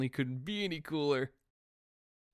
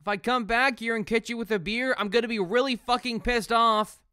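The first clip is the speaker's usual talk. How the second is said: mock warning; 4 semitones higher, 6 dB louder than usual. Warning the homeless guy.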